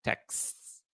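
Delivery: "Texts" as a single syllable; quiet, isolated "Texts"; one-syllable pronunciation. Shown as a demonstration. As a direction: end "Texts" on a hissed cluster, no extra vowel. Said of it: "There's no Texas".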